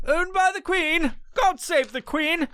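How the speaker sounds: high, slow, put-on posh British accent; mock British toast to the Queen. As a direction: lift it into a hammed-up British royal voice. Mocking the British crown.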